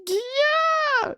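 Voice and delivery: high, drawn-out mock cheer; imitated screaming fans; cheering crowd. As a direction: squeal it like a whole crowd going wild. And everyone's like.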